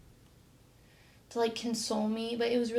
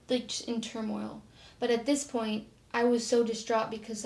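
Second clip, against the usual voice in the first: ordinary delivery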